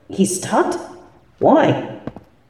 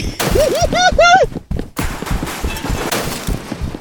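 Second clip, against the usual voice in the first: gleeful laugh; 5 dB quieter than usual. Acted. Villain mocks fleeing victim.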